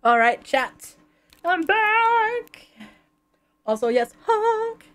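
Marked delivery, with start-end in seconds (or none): 1.4-4.8 high goofy honking voice; silly clown. Pretend. Answering chat with honks.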